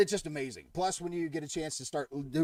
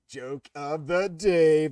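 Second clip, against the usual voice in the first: slow, drawn-out title call, louder; segment announcement; 8 dB louder, much slower than usual. Acted. Host announcing his joke card to viewers, slow and loud.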